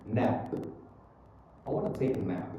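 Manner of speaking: "nap" shut off on the lips at P; closed final P. Shown as a demonstration. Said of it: "itu wajib ditutupin".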